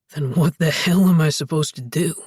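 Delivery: weary hostility. A narrator's reading. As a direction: snap it out, worn down and resentful. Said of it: "Alex asked in weary hostility".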